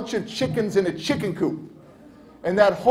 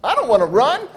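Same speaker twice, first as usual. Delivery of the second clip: put-on louder, higher kid's protest; acted childish refusal; defiant refusal; 5 semitones higher, 6 dB louder than usual. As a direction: dig in like a stubborn child. Frustrated kid refusing.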